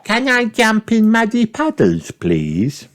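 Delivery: put-on high pleading little girl. A narrator's reading. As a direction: ask sweetly like a small child. Peppa voiced high.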